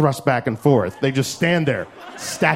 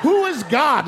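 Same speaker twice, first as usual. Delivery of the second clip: ordinary delivery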